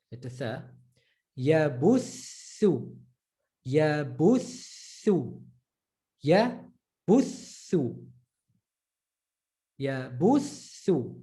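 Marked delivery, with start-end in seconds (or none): 1.4-11.1 Yabuthu, slow, the th doubled and stressed; stressed doubled tha. Shown as a demonstration. Teacher drilling the stressed doubled th slowly. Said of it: "Letter doubled and then stressed".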